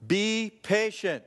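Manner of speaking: slow, loud and high; emphatic. Shown as a demonstration. Preacher stressing it to the church, slow and loud. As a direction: press each word home. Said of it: "please write this down in bold print".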